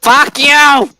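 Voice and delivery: shout. Real, not acted. Yelling at his mic.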